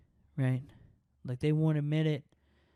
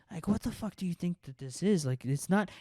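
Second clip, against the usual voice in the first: ordinary delivery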